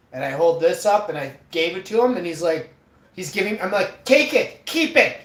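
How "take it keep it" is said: higher, louder, punchy urging; mock-emphatic insistence; insistent retold offer. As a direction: push it on him, acted out. Retold insisting while handing over money.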